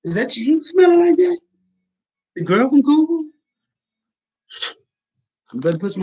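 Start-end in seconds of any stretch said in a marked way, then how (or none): 0.0-3.3 high, loud mock disgust at her breath; mock disgusted shriek. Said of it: "And I'd be like".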